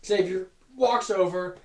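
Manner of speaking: ordinary delivery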